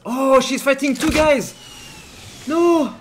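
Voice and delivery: loud, drawn-out cry of alarm; alarmed shout. Real, not acted. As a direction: cry out high as your teammate is outnumbered. Sees teammate outnumbered in fight.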